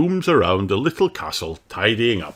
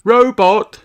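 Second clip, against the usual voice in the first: high, much louder call; 10 dB louder than usual. A narrator's reading. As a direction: call the robot to attention. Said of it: "says Ben".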